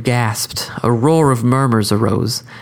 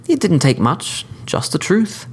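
higher, refined courteous assassin; cordial sophisticated killer; 6 semitones higher than usual. Acted. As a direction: lecture the court, smooth and polite. Skidmire answers.

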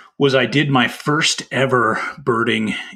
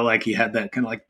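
usual speaking voice

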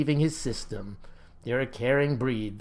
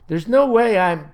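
louder, higher outburst, cut off; indignant protest; 5 semitones higher, 7 dB louder than usual. A narrator's reading. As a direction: protest hotly until interrupted. Boy protests, cut off mid-sentence.

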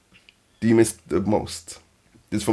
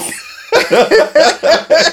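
high laugh; 5 dB louder than usual. Real, not acted. Host laughs at co-host.

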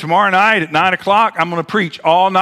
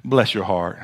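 Southern saying; 10 semitones lower, 5 dB quieter than usual. Retold or imitated. Pastor quoting a Southern saying, soft and low. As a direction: say it like a gentle Southern aunt. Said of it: "in the South we have a saying".

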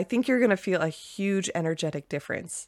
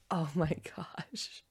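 whispered, laughing "oh my gosh"; delighted gasp; 11 dB quieter, much slower than usual. Shown as a demonstration. Host showing the feeling she wants listeners to find, laughing whisper. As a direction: let the joy escape as a hushed giggle. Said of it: "find something that gives us that".

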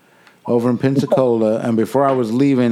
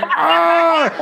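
very high, held mock wail; high sustained howl of pain, re-enacted. Friend re-enacts agony after finger is released, pained wail.

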